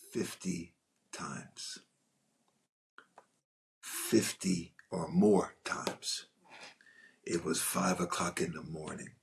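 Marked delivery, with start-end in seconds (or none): 0.1-6.3 very slow, drawn out, trailing into a whisper; emphasis on fifty. Real, not acted. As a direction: drag out fifty to show how endless it was. Stressing exhausting retakes.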